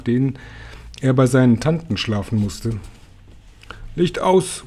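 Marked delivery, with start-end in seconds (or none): 3.9-4.6 sleepy mumble. A narrator's reading. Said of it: "murmelte Tausendfüßler verschlafen".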